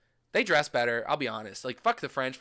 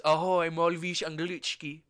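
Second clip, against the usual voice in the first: slow Czech-accented greeting; Czech phrase demo; 3 semitones higher, slower than usual. Shown as a demonstration. Tourist demonstrating a question in Czech, slow Czech pronunciation. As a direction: sound out the Czech carefully and slowly. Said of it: "you know, Czech is".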